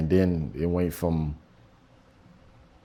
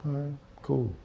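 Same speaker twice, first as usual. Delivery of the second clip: much softer, sheepish; meek; 5 semitones higher, 10 dB quieter than usual. Retold or imitated. Patient embarrassed in front of the nurse, softly sheepish. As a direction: mumble it, embarrassed.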